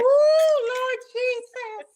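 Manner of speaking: high, slow drawn-out Lord Jesus; dramatic exclamation. Real, not acted. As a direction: react with mock alarm.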